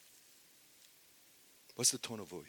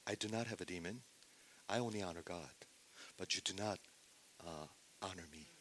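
softer, calm and unhurried; cool and collected; 4 semitones lower, 17 dB quieter, faster than usual. Retold or imitated. Jesus answering his accusers calmly, cool and collected. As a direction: answer without getting fired up. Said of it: "speaks in a very collective and cool tone".

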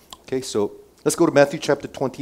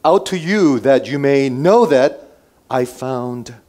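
raised, projected proclamation; addressing a crowd; 2 semitones higher, 5 dB louder, slower than usual. Acted. Pilate presenting Jesus to the crowd, loud proclaiming. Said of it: "and said to the crowd".

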